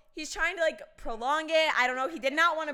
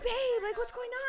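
feigned innocence; 10 semitones higher than usual. Retold or imitated. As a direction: ask sweetly as if nothing happened. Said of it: "I'm like".

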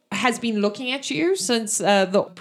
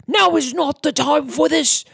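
angry orc priest; 4 semitones higher, 4 dB louder than usual. Acted. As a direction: bark orders like an impatient orc. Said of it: "And the orc says".

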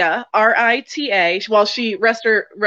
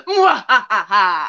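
half-hearted villain laugh; evil laugh on request; much slower than usual. Pretend. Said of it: "Dude, do the evil laugh".

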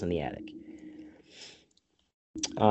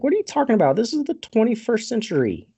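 high-pitched, louder disbelief; mock incredulity; 11 semitones higher, 3 dB louder, faster than usual. Podcaster voices Toby answering a begging man, incredulous. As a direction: act out exasperated bafflement. Acted disbelief at villager's plea.

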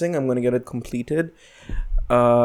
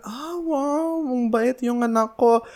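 high sing-song mom voice, much higher; mock delighted mom; 12 semitones higher, slower than usual. Son imitates mom praising him for the gift, high mock gush. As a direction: gush like a proud mother, pitched way up.

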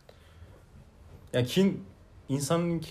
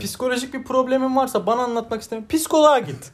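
mock dismissive; 8 semitones higher than usual. Telling a partner with problems to see a shrink, scoffing. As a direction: send them off with a scoff.

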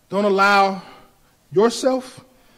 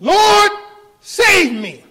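high, loud cry for help; desperate cry; 11 semitones higher, 8 dB louder, much slower than usual. Retold or imitated. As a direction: cry out like a sinking man. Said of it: "Peter screams immediately".

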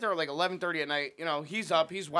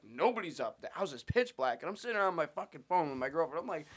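plain reading voice

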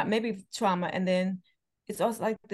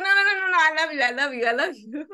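put-on little boy; 6 semitones higher, 6 dB louder, faster than usual. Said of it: "He's like".

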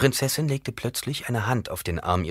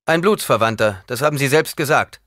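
loud, raised rejoinder; insistent retort; 7 semitones higher, 8 dB louder than usual. A narrator's reading. Paul reminding the Emperor of his admission, insistent. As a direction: cut in forcefully.

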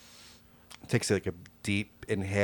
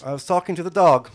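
high, loud urchin voice fawning over the dog; cheeky urchin sweet-talking a giant dog; street kid voice; 9 semitones higher, 11 dB louder, faster than usual. Acted. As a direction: coo at the big dog like a scrappy street kid.

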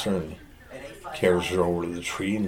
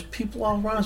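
much higher, light objection; high girl voice. Retold or imitated. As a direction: pitch it up as the girl. Joke, woman's objection voiced.

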